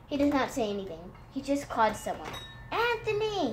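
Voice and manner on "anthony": high-pitched call, no real volume; mild storybook yell, high; yelled name. A narrator's reading. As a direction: call for someone in another room, pitched high. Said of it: "he yelled".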